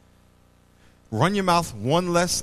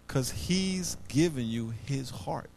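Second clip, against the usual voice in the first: soft, slow, much quieter tender words; tender reassurance; 4 semitones lower, 9 dB quieter, slower than usual. Retold or imitated. Preacher tells the crying young man, tenderly and softly. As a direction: lower and soften it, almost a hush.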